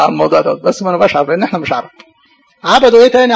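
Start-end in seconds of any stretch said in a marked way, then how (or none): 2.6-3.3 emphatic preaching question. Real, not acted. Rhetorical question to the congregation.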